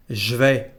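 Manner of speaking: "je vais" said zh-vay, no E; contracted je. Shown as a demonstration. Teacher showing the second contraction, "je vais" said as zh-vay. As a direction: buzz zh straight into "vais". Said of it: "without the letter E".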